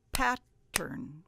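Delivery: "Pattern" broken into PAT... tern; two parts. Shown as a demonstration. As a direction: separate "Pattern" into two syllables with a gap. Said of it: "There's two parts".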